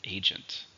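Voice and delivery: "Agent" with the stress on A; stress on first syllable. Shown as a demonstration. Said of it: "stresses on the first syllable".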